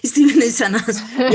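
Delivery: laughing it out, fast and loud. Real, not acted. Cohost laughing while agreeing that Steven is awful. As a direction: blurt it through a real laugh. Laughing repeat of friend's remark.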